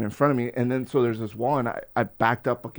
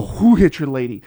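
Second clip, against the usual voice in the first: loud retold challenge; urgent demand; 2 semitones higher, 9 dB louder than usual. Man asking the lady who did it, loud demand. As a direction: call out the question urgently.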